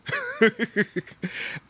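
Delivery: a high-pitched laugh; high burst of laughter. Real, not acted. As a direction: laugh out at the absurd thought. Laughing at own joke.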